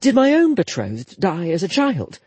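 put-on inquisitive young woman. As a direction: probe gently with questions. Jetta questions Akir.

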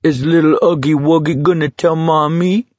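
put-on high baby-talk taunt, rising at the end; mock him like a toddler; jeering singsong call; calling back. A narrator's reading. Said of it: "Kintz called back".

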